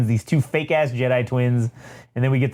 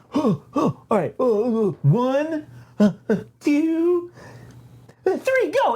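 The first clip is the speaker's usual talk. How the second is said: high, huffing count-in; pitched-up mock panic before attacking; nervous countdown; 12 semitones higher than usual. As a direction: psych yourself up in a high, hesitant voice. Twins psych themselves up to attack.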